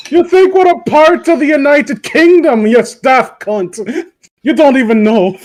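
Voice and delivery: a mock-aggressive Scot jeering; Scottish accent. Playful Scottish role-play.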